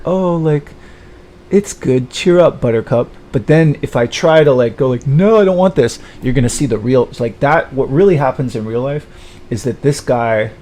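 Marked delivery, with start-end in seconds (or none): 0.0-3.1 high, louder mock pep talk; patronizing consolation, pitched up; mock cheering-up. Someone consoling him about settling, mock cheering-up. 5.0-6.0 high, loud protest; acted refusal. Him turning down the unwanted match, loud refusal.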